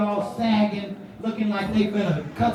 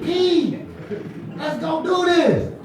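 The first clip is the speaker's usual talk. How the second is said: excited urging; 8 semitones higher, slower than usual. Retold or imitated. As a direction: urge him on, breaking into a laugh. Asks the friend a second time.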